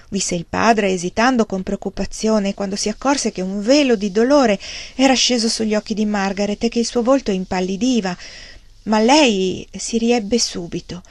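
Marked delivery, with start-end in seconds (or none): none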